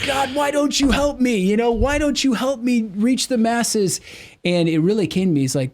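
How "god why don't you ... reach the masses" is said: high, strained comic wail to God; mock pleading. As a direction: act out a whiny, exasperated prayer. Acts out his complaint to God.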